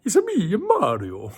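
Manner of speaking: cartoon Italian accent, leaping high and loud; Mario, Italian voice. Shown as a demonstration. Streamer answering a chat request, exaggerated Italian voice. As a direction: exclaim in a hammed-up Italian accent. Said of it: "can I do an Italian voice?".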